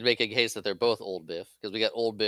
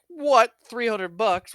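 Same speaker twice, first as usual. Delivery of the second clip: much higher, louder disbelief; outraged surprise; 12 semitones higher, 7 dB louder, much slower than usual. Retold or imitated. Biff hearing the repair bill, loud shocked outrage. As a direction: shoot up in shocked outrage at the price.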